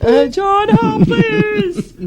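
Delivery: high, whiny call for help; high mock plea. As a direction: squeak a pitiful plea for help.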